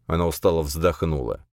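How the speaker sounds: ordinary delivery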